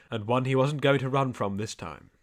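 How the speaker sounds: usual speaking voice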